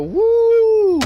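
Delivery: long high, loud whoop; excited yell. Retold or imitated. Friend recalls hearing the rider, loud high whoop. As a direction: whoop out a big, high "woo". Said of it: "he just heard me go".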